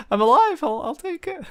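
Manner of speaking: very high, squeaky-pitched relief; hammed-up high-pitched reaction; mock relief. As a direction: exaggerate being glad to survive. Acts out relief at surviving visit.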